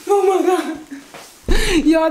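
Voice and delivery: normal talking voice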